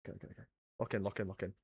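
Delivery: very low, barely audible muttering; tiny, hushed pep talk; muttered self-focus. Real, not acted. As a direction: psych yourself up under your breath. Psyching self up.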